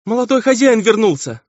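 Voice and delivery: very high-pitched alarmed wail; terrified maid's cry. A narrator's reading. Maid runs from Emil's room, wailing in terror. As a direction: cry the news out high in horror. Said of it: "вопящая от ужаса служанка".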